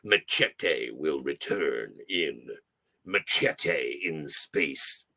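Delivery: deep, slow movie trailer announcer; epic trailer voice. Shown as a demonstration. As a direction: boom it out like a blockbuster trailer. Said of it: "in the epic, uh, uh, epic trailer".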